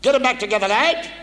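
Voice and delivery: high, loud drill-instructor bark; barking petty officer. Retold or imitated. Chief petty officer barking at the trainee lads. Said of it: "he said".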